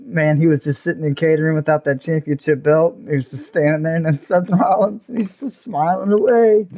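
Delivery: ordinary talk, nothing marked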